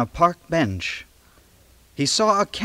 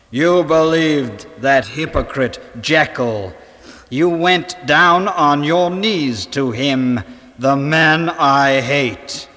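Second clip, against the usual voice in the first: slow, louder menacing snarl; 5 dB louder than usual. Acted. Hyde snarling at Ivy, slow and loud. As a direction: snarl it like a returning monster. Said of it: "he snarled".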